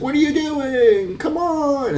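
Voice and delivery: very high, slow, put-on squeal; mock exasperated yell. Adam Pierce as the ambulance stops, very high mock exasperation. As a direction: screech it in mock disbelief. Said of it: "He's like".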